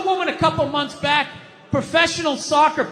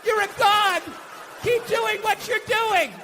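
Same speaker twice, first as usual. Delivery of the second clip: mock gushing praise; 6 semitones higher than usual. Mocking fans who praise a passed-out alcoholic, fake gushing. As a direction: cheer on a drunk as if he were a hero. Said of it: "you'd be like".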